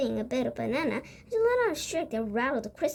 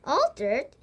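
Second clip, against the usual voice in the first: mild shout; 4 dB louder than usual. Acted. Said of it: "shouted Mister Jenkins".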